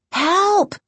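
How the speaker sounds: high-pitched yelp for help; short, high cry of terror; frightened cry. A narrator's reading. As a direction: cry out in panic. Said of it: "Casey cried".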